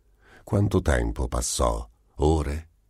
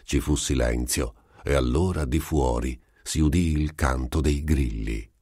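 plain reading voice

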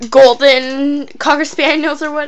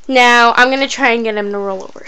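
normal talking voice

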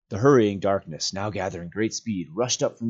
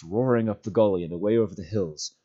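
usual speaking voice

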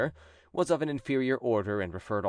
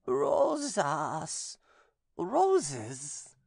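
much higher, slow, eerie possessed voice; possessed nun; 8 semitones higher, much slower than usual. A narrator's reading. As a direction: speak as the demon inside her, slowly. Possessed nun answers exorcist.

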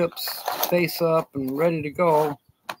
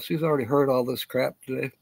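ordinary talk, nothing marked

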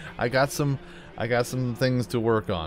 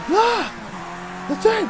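angry shout; slower than usual. Retold or imitated. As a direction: blow up at the game. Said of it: "'cause I'm angry".

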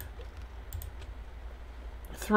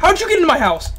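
high, loud alarmed yell; panicked shout; 10 semitones higher, 6 dB louder, much faster than usual. Real, not acted. Gamer yelling at the monster inside the house, shouting. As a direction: yell in disbelief.

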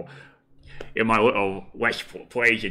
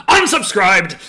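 louder, higher huffy shout; mock indignant shout; 6 semitones higher, 10 dB louder, slower than usual. Reading a chat comment as an indignant shout. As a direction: slam the door as a fan. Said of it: "Connell McBride says".

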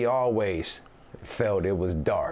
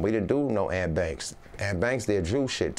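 ordinary talk, nothing marked

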